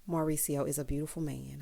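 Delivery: soft, low, dreamy praise; hushed dreamy gush; dreamy admiration. Real, not acted. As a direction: sigh it out like a crush.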